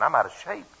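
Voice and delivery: usual speaking voice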